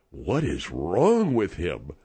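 low, slow, baffled aside; muttered aside. Retold or imitated. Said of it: "I went in the back and I said".

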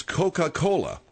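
"Coca-Cola" slowly, with all four syllables; full pronunciation. Shown as a demonstration. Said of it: "it's called Coke because it's".

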